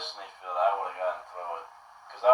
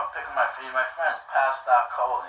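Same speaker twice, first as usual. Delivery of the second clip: ordinary talk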